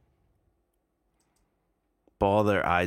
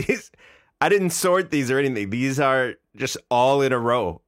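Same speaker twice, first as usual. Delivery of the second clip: laughing; 5 semitones higher, 4 dB louder than usual. Real, not acted. Laughing at identical comments.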